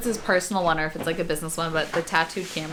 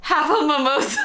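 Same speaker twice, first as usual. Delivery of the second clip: laughing, high and giddy; tipsy giggly repeat; 10 semitones higher, 6 dB louder than usual. Real, not acted. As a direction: giggle the words out.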